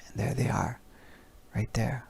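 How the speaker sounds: barely a breath, secretive; hushed whisper. Pretend. Hiding behind the coats watching the elves, hushed whisper.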